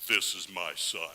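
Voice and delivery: deep, booming voice of God; solemn divine voice, low and resonant. Pretend. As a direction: intone it like God speaking from the cloud. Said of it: "In my mind, it sounds something like this".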